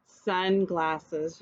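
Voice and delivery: put-on a low, careful "Sunglasses" for children to repeat; modelled word. Shown as a demonstration. Teacher leads children in repeating the word. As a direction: model "Sunglasses" for the class to echo. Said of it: "Can you say it with me?".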